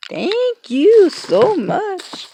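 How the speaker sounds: high, slow, drawn-out thanks; thrilled gratitude. Real, not acted. Vlogger thanking a friend for gifts, thrilled and drawn out. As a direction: gush it high, stretching each word.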